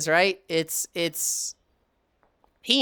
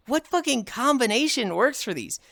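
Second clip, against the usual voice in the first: higher-pitched, baffled reenactment of struggling; exasperated puzzlement; 8 semitones higher than usual. Retold or imitated. Player reenacting their Wordle struggle, exasperated. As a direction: act out being stumped, voice swinging up. Acts out his baffled guessing.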